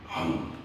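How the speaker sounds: low mouth-made gobble; chomp. Acted. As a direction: swallow it in one low gulp of sound.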